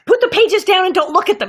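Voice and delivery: high-pitched, emphatic order; urgent instruction. Retold or imitated. Father coaching his daughter before the audition, urgent emphatic instruction. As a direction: give the order with excited insistence.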